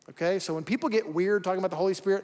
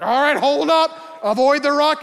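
higher, louder warning shout; calling out; 8 semitones higher, 8 dB louder than usual. Retold or imitated. Raft guide shouting a warning to the rafters. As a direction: yell over the rapids to the crew.

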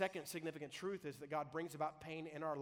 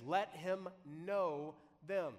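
"Let him know them" very slow and a bit louder, leaning on "know"; slow emphasis; 5 dB louder, much slower than usual. Shown as a demonstration. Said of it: "I would circle the word know".

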